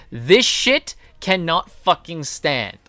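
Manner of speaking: ordinary delivery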